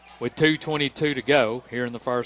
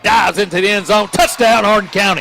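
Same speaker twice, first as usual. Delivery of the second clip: touchdown call; 6 semitones higher, 7 dB louder than usual. Real, not acted. Live touchdown call by announcer.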